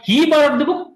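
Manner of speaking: single out "he" as the wrong person; stress on HE. Shown as a demonstration. Teacher contrasting him with somebody else, stress on "he". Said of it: "third person had borrowed the book".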